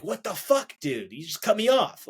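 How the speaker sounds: hammed-up angry outburst at a driver; mock road rage. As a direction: imitate a furious driver who got cut off. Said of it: "that anger that can bubble up".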